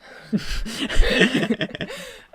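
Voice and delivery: high burst of real laughter; big laugh. Real, not acted. Host cracks up at the Birmingham guess, loud high laughter. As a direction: crack up loudly at your own joke.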